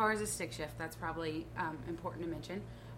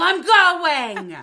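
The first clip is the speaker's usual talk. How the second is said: much higher and louder, a furious shout; angry yell; 9 semitones higher, 19 dB louder than usual. Retold or imitated. Flipping off the truck driver, yelling angrily. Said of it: "give him the finger and yell".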